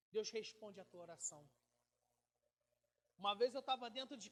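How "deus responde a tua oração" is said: usual speaking voice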